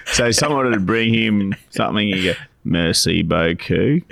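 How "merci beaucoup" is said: put-on slow French in a broad Australian accent. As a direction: imitate an Aussie speaking French, slowly. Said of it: "in an Australian accent".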